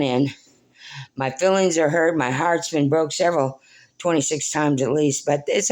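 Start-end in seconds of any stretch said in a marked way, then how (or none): none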